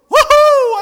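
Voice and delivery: very loud, high-pitched whoop; loud mock-triumphant whoop; gleeful whoop. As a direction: let out a playful woohoo, pitch soaring. Imagines himself taking revenge.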